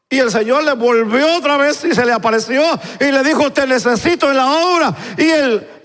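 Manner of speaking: high, driving preacher's storytelling; impassioned preaching. Acted. Preacher retells God's call and the man's excuse, impassioned.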